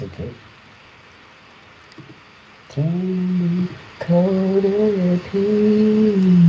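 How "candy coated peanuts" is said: drawn-out high voice. Real, not acted. Savoring a tasty snack.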